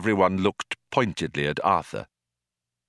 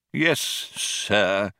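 cold. Acted. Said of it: "said the old man coldly".

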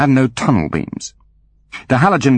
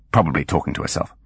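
ordinary delivery

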